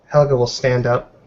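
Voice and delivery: ordinary delivery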